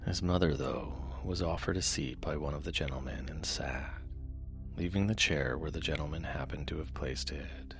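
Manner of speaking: soft, low bedtime reading; sleep story narrator. A narrator's reading. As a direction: read gently to lull listeners to sleep.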